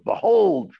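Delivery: a high, loud mock-solemn Behold; mock biblical. Shown as a demonstration. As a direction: proclaim it like an old prophet, half joking. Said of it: "that's not English".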